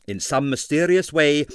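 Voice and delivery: ordinary delivery, nothing marked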